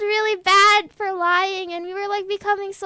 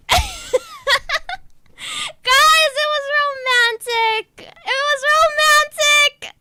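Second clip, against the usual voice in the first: loud, high gushing outburst; excited insisting; 8 semitones higher, 7 dB louder than usual. Real, not acted. Teller defends the message to listeners as romantic, loud excited gushing.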